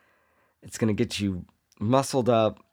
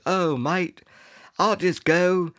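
higher-pitched comic British bloke; mock British accent; 7 semitones higher, slower than usual. Retelling a guy in a European video, mock British accent. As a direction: do a chirpy put-on British accent. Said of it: "it was in Europe".

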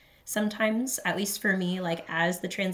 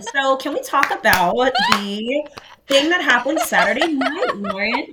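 high, loud, slow teasing with a laugh; teasing build-up; 7 semitones higher, 10 dB louder, slower than usual. Real, not acted. Host teasing her co-host about Saturday night, loud and laughing. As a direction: drag it out like you're about to spill juicy gossip.

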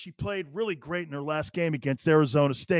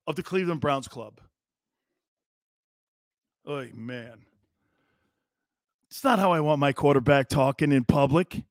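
normal talking voice

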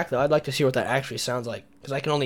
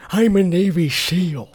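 slow, gruff tough-guy voice; Jesse Ventura impression; 4 semitones higher, 4 dB louder, slower than usual. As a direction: growl it like a wrestler governor. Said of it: "Remember my Jesse Ventura?".

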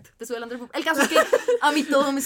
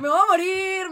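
hammed-up panicked wail, higher and louder; mock panic; 7 semitones higher, 5 dB louder, slower than usual. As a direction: wail it like you're about to die. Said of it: "Y yo". (pánico exagerado, más agudo y fuerte)

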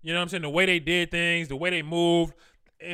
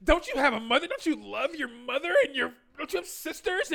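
drawn-out mock moral shock, climbing higher; disapproving outsider; 4 semitones higher than usual. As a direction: imitate a scandalized onlooker pleading, slow then high. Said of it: "other people like from the outside".

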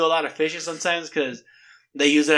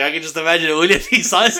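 usual speaking voice

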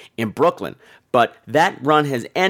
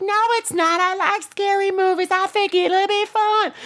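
put-on squeaky, very high insistent child; little girl. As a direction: play a tiny girl arguing back. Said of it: "She's like".

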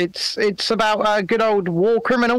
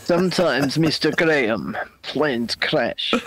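mock menacing threat; 7 semitones lower, slower than usual. Quoting Medvedev's threat menacingly.

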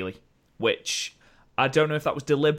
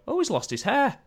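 put-on Scottish accent; 13 semitones higher than usual. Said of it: "in a really thick Scottish accent".

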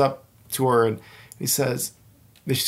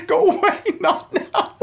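high, laughing go away, played for comedy; laughing brush-off; 4 dB louder than usual. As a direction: shoo him off through a laugh. Brushing off the flight attendant.

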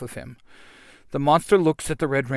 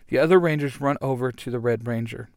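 plain reading voice

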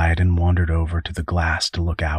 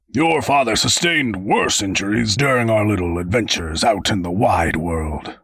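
good spirits; 3 semitones higher than usual. A narrator's reading. Raymond to Woodrow amid the destruction, in good spirits. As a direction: play the adventurer in good spirits. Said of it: "Raymond seemed to be in good spirits".

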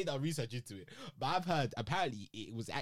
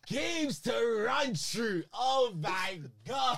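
loud, high, stretched-out disbelief; excited shouting; 10 semitones higher, 5 dB louder, much slower than usual. Real, not acted. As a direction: shout it slowly in excited shock. Excited shock at football news.

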